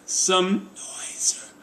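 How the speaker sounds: whispered noise call. Acted. Wife's second call for noise, whispered. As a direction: breathe it with no voice.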